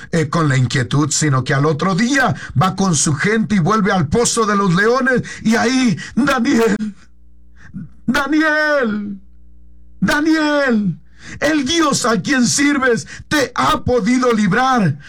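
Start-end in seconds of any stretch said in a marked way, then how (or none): 6.2-15.0 rising, anguished calls with a higher pitch; king calling out. Acted. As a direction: cry out to Daniel down in the pit. King calling into the lions' den. (el rey angustiado llama a Daniel en el foso de los leones)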